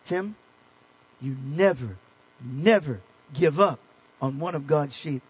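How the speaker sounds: put-on tender firm encouragement to a young pastor; gentle mentor. Old mentor gently urging Tim never to give up. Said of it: "he says".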